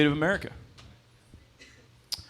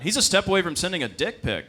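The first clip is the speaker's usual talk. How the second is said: ordinary delivery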